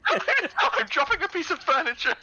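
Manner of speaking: high-pitched, talking through laughter; laughing. Real, not acted. Player owning up to an absurd drastic move, laughing. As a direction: crack up while getting the words out.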